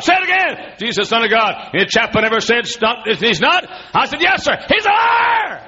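high, loud, shouting preacher; preaching at full force. Acted. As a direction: preach it out, rising to a yell.

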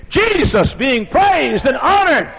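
high, drawn-out preacher's shout; ringing, exultant climax. Real, not acted. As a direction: proclaim it slowly at the top of the sermon. Climax of the sermon's question.

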